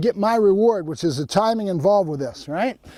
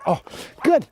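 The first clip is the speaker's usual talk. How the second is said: normal talking voice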